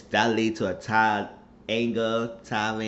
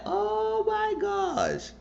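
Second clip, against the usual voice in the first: high, slow, drawn-out "Oh my gosh"; stunned gasp; much slower than usual. Real, not acted. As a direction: let the disbelief stretch up high. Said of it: "I was like".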